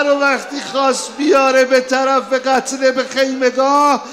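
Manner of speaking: loud, high lament for a martyr; full-voiced mourning chant, loud and high; preaching at full force. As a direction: cry the tragedy out to the mourners.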